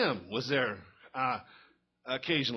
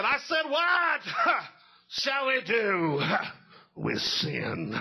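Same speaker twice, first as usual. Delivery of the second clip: high, shouted revival preacher, huffing after each phrase; preacher voice number two; 9 semitones higher, much slower than usual. Acted. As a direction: shout "what", then drag out the rest slowly. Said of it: "he got in preacher voice number two".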